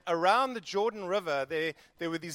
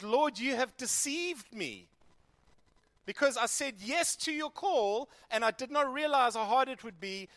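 pained complaint; 5 semitones higher than usual. Acted. Jeremiah complaining to God, high and pained. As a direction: plead your hurt to God.

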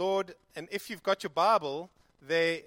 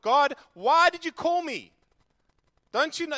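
prophet crying out why to heaven; anguished cry to God; 9 semitones higher, 9 dB louder than usual. Acted. Jeremiah crying out to the Lord, loud and anguished.

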